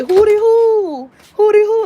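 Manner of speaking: high, loud hootie hoo call like Master P; playful imitation of a rap catchphrase. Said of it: "you remember Master P".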